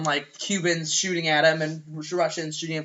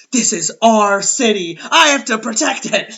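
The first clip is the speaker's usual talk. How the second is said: put-on determined kid; 4 semitones higher, 9 dB louder than usual. Kevin's resolve.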